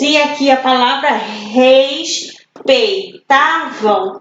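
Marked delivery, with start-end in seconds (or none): 1.1-4.2 respeitavam slowly broken RES, PEI, TA, VAM; slow respeitavam in four separate beats; syllable by syllable. Shown as a demonstration. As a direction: pause after every syllable of respeitavam. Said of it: "quatro sílabas".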